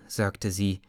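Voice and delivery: ordinary delivery, nothing marked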